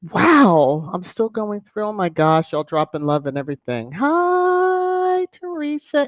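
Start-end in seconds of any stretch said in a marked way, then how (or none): 3.9-6.1 high held hi; sing-song hi. Real, not acted. Host greeting viewer Teresa with a high held hi. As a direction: stretch the hi up high.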